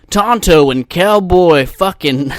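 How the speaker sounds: loud, slow, hammed-up country DJ; country radio host. Podcaster mocking country radio hosts, hammed-up announcer. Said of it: "country radios, dude, where they're just, like".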